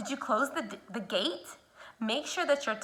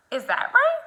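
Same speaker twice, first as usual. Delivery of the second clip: pitched way up; high teacher check; 4 dB louder than usual. Shown as a demonstration. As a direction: ask it high, teasing. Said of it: "I didn't have my tongue in the right place".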